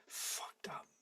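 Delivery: under-breath whisper; hushed, voiceless curse; whispered aside. Real, not acted. Aside after a grim fact.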